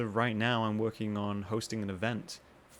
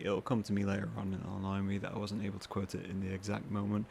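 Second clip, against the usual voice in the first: usual speaking voice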